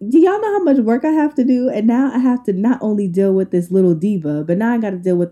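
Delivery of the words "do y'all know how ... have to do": fast, high and a bit louder, exasperated; rapid exasperated complaint, pitched way up; annoyed outburst. Retold or imitated. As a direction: fire it off like a fed-up mom. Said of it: "And I was so annoyed".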